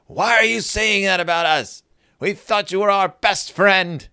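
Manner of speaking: high, loud mock outrage; hammed-up hurt protest; indignant shouting. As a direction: cry out as betrayed friends. Hurt friends confront Sister.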